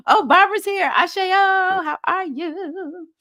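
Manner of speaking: delighted greeting. Real, not acted. Host spotting Barbara joining the live, delighted high greeting. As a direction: sing out a happy welcome. Greets a viewer who just arrived.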